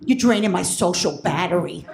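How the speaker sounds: low, weary brush-off; fed-up son. Retold or imitated. College son telling his mother to back off, fed up. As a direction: push mom away in a low voice.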